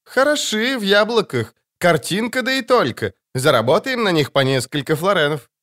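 lightly voiced bandit girl, pleased; young robber girl. A narrator's reading. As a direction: size up the team with glee. Iskra voiced by narrator.

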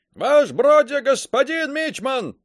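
high, loud straining shout; strained yell. A narrator's reading. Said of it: "надсаживаясь, заорал Каблуков".

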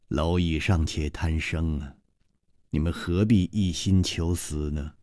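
low, soft, cold disdain; quiet, icy pity; frowning lady. A narrator's reading. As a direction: look down on them and sigh it. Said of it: "皱了皱眉".

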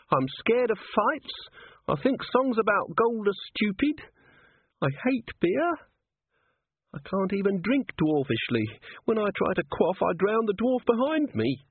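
very high, upset little voice; distressed young dwarf. A narrator's reading. Cheery confessing to Angua about not fitting in, distressed. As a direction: confess failings in a high-pitched lament.

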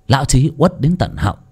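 ordinary delivery

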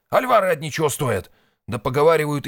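high, loud exclaiming; agitated warning. A narrator's reading. Red nervously listing deadly rivals, agitated. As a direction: pile up the dangers excitedly.

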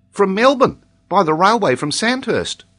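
put-on high, loud child with accent; Tolini. A narrator's reading. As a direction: pipe up as an accented little boy. Child answers in character voice.

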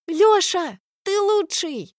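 high excited shout. Acted. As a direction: cheer him on loudly. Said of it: "кричит Аля".

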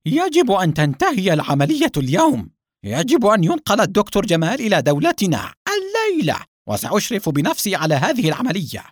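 loud, high furious orders; irate boss laying down the law; angry shouting. A narrator's reading. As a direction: bellow demands at your men, pitched high. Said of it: "وصاح غاضبًا".

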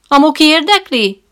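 high, loud hearty call to the visitor; loud booming shout. A narrator's reading. Trainer hailing the newcomer, loud and hearty. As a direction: boom it out like a showman greeting a guest. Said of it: "kiált rám harsányan".

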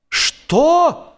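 loud, high shocked yell. A narrator's reading. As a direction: bellow a single outraged word. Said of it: "заорал Джордж".

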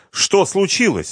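high, loud alarmed woman; agitated wife's question; wife asking. A narrator's reading. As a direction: ask anxiously in a high voice. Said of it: "спросила она".

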